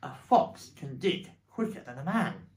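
loud, rousing declaration; mild excited shout. Acted. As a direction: proclaim it loudly to the family. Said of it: "shouted Mister Fox".

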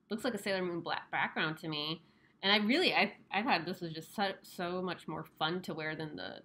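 muffled behind mask fabric; speaking masked to camera; through a face mask. Real, not acted. Seen 1: Surgical-style mask covers mouth and nose throughout; references unmasked.

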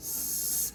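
"স-" as a bare, thin hiss of air. Shown as a demonstration. Teacher showing how air comes out, thin hiss. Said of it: "বাতাস বের হবে".

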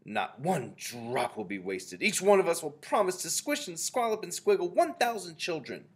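loud witches' pledge, climbing high; chorusing witches. A narrator's reading. Ancient Witches promising their leader to squish children, chorused. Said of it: "chorused the Ancient Witches".

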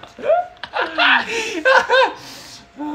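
high-pitched burst of loud laughter; big high laugh. Real, not acted. Cohost laughs loudly at being ignored, squealing.

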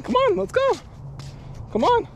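very high, squeaky pet call; coaxing the dog. Real, not acted. Owner urges tired dog homeward, very high coaxing. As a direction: chivvy the dog along in falsetto.

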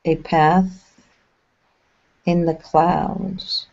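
unhurried guide voice with long pauses between phrases; measured, spacious phrases, leaving silence to settle; guided meditation. Acted. Channeling a meditation for listeners relaxing along a path, guided meditation.

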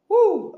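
a sudden high, loud woo of joy; excited whoop. Real, not acted. Shouting woo at the new lights.

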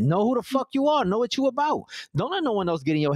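high, pushing every word; fired-up rallying cry. Real, not acted. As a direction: pump them up, fierce and high. Impassioned pep talk to listeners.

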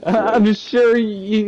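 slow, high laughing words. Real, not acted. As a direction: talk through laughter. Laughing at enemy.

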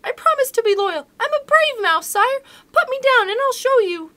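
very high, loud little mouse squeak; frightened mouse pleading with the lion, squeaky. Acted. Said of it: "Mouse cried".

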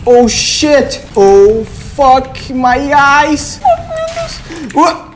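slow drawn-out cry ending in a high squeal; terrified. Real, not acted. Gamer facing a monster, drawn-out horrified cry. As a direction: stretch it out in horror then squeal.

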